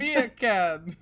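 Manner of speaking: put-on Jamaican accent. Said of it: "The Jamaican accent is great".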